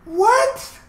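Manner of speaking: high, disbelieving "what"; stunned what. Real, not acted. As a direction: shoot it up in disbelief. Stunned by the verse.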